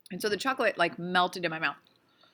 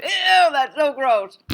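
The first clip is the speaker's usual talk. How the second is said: loud, slow, drawn-out disgust; 6 semitones higher, 10 dB louder, much slower than usual. Real, not acted. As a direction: recoil at the taste, stretching every word. Disgust at the taste of seafood.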